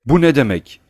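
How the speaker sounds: loud, high shocked question; stunned outrage. A narrator's reading. Tarantiyev gasps at Oblomov after the slap, stunned and loud. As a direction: burst out as if just slapped. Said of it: "nefesi durmuş bir halde".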